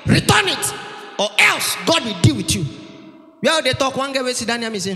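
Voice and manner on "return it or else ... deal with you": high, raised pulpit warning; preacher's booming warning. Acted. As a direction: thunder a warning at the whole crowd. Preacher warns crusade crowd of thieves.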